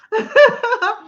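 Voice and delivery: short, high, real laugh. Real, not acted. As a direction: laugh at the comment. Laughs at listeners' reply.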